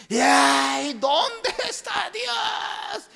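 very high, slow, sobbing cry; crying shout to God. Acted. Man sitting on beach cries out where God is, high slow sob. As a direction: wail at the sky, crying. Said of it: "él le gritaba".

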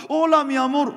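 high, bright-up mock hello, mi amor; lightly put-on friendly shopkeeper; imitated sweet greeting. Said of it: "también me saludaba".

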